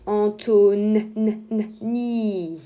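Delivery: loud "En tau", then "n, n, ni" held and blended; sounding out syllables. Shown as a demonstration. Teacher sounding out the N for pupils, drawn-out phonics. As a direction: stretch "n" before landing on "ni". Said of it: "Le N".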